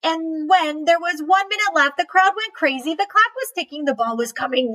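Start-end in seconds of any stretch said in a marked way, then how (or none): none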